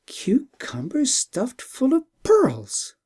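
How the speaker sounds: slow, breathless astonished cry; amazement. Acted. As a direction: gasp it out in disbelief. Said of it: "cried Princess Periazad with amazement".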